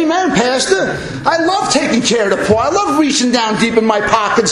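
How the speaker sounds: high, fervent mock response; eager congregant. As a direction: gush it as an overzealous church member. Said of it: "You're supposed to say".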